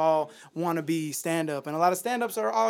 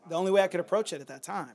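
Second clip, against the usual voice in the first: usual speaking voice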